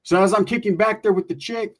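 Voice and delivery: ordinary delivery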